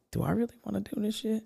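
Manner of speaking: high, quick, soft voice of self-doubt; doubting inner voice. Retold or imitated. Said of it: "and I was like".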